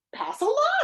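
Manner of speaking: high, perky suggestion; brightly. A narrator's reading. Humbug offering the king a solution, high and bright. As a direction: pipe up eagerly with an idea. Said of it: "suggested the humbug brightly".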